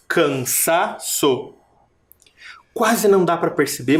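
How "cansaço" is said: slow Cansaço with the SA stressed. Shown as a demonstration. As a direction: pull Cansaço apart and lean on SA. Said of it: "mas é o sa". (Cansaço devagar, destacando o SA)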